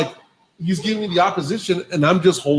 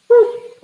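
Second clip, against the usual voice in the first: high, loud little boop; imitated boop; 6 dB louder than usual. Driver showing the one-shot parking move, playful boop. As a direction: pop out a high sound effect. Imitates slotting the trailer in perfectly.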